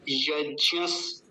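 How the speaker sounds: यज्ञस slowly, gliding into the final s; proper ज्ञ. Shown as a demonstration. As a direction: draw out यज्ञस with a clear ज्ञ and closing स. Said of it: "ये गलत उच्चारण है". (यज्ञस धीरे, ज्ञ स्पष्ट)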